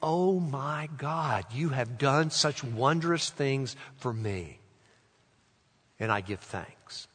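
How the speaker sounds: slow, soft prayer of gratitude; hushed, wondering praise; reverent thanks. Shown as a demonstration. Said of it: "Let's make it".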